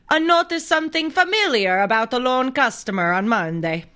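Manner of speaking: high, singsong mock Italian accent. Host reading arrest report, hammed-up Italian accent. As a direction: read the news like a cartoon Italian. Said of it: "talk in my Italian accent".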